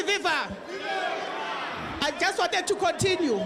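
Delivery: ordinary talk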